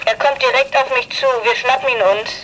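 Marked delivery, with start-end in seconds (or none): none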